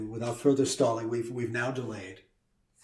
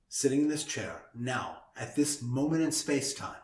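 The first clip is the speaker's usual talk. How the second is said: ordinary delivery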